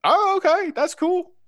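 high squeaky little gnome. Acted. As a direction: chirp it high like a tiny gnome. Gnome character voice.

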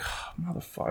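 hushed, whispered curse; muttered dismay. Retold or imitated. Customer cursing to himself about a hovering clerk, muttered dismay. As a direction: swear under your breath.

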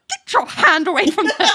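high, exasperated telling-off; mock scolding. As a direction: scold the character, half laughing. Scolding TV character while recapping.

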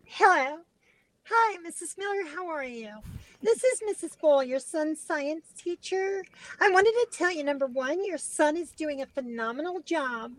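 high put-on woman's voice; phone manner of a fake lady teacher, way up high; Missus Ball. Teen posing as science teacher to a mom, high-voiced. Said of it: "so hard to do the voice".

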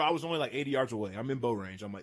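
ordinary delivery

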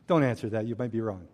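low deadpan aside; wry aside. Real, not acted. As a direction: toss it off to the crowd as a quiet joke. Joking aside to congregation.

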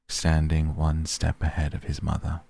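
ordinary delivery, nothing marked

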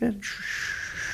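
hushed, slow shushing; calm bad guy hushing; really calm. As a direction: soothe them with a soft shh. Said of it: "the bad guy's really calm".

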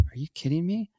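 surprised daughter. Retold or imitated. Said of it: "she's like".

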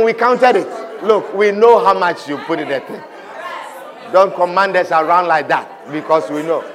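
low, measured angel reply; admonishing angels. Acted. Angels answer a short-paying tither: low admonishment. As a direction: put the tither in his place, low and firm. Angels rebuke the small giver.

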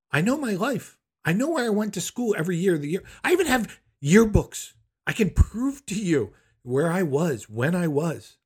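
high-pitched, emphatic protest; indignant insistence. Retold or imitated. Son mentally rebutting mother's invented story, indignantly. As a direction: insist on the facts, pitched well up.

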